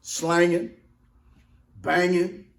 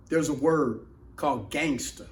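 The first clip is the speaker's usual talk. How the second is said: ordinary delivery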